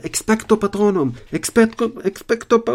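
high, loud desperate incantation; shouted spell. Acted. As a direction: yell the spell against the dementor. Harry casts spell at dementor.

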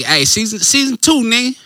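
high, loud hype shouts of "season two"; hyped announcement. Real, not acted.

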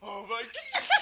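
loud laugh breaking through words; laughing shout. Real, not acted.

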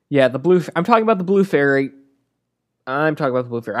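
normal talking voice

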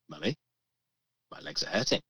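imitated child, soft low "legs", lifting on "hurting"; child's complaint, rising end. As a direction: say "hurting" softly with a questioning lift. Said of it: "I'm changing the way I say it".

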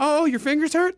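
high, laughing sarcasm; mock her complaint with a laugh. Mocking grandma.

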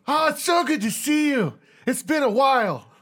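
high-pitched, gushing reunion voice; overexcited underdog greeting an old pal; eager pledge voice. Acted. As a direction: gush it high, stretching the words. Dog Shit gushes at old friend.

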